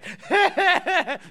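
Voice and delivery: high, loud cackling laugh; sudden high peal of laughter; burst of laughter. Real, not acted. Preacher to the sister receiving money, high laughing burst.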